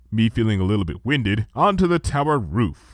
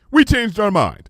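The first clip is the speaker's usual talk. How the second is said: breezily; 10 semitones higher, 5 dB louder than usual. A narrator's reading. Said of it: "Luna said breezily".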